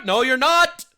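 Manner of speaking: mock angry shout. Shouting down the pretend Canadian, loud and hammed-up angry. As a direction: shout him out of the room.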